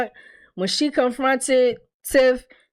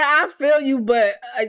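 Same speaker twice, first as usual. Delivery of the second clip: normal talking voice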